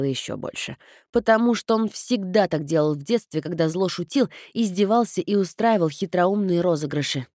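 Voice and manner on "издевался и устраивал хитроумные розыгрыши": usual speaking voice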